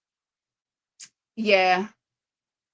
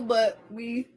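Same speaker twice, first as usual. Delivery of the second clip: normal talking voice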